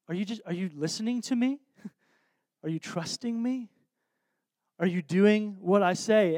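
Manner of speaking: soft, tender questions with pauses; gentle questioning. Acted. Jesus asking a friend whether they trust him, soft and gentle. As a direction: ask it quietly, inviting trust.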